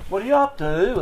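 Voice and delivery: put-on chirpy English boss voice with a Pommy accent; imitating a lilting English accent from the drawing board; Derek's Pommy accent. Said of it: "the sing-songy sort of a, uh, you know, Pommy accent".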